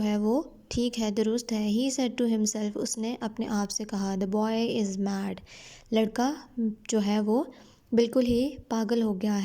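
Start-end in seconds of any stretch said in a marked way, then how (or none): 4.1-5.3 slow, careful reading of the English text; slow story reading. A narrator's reading. Teacher reading the English line slowly to her students. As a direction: read each word out slowly for the class.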